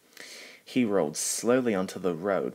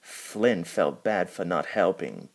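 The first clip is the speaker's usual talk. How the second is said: plain reading voice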